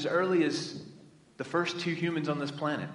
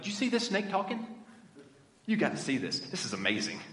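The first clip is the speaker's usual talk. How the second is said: mock excited Eve; 3 dB quieter than usual. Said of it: "She doesn't say that".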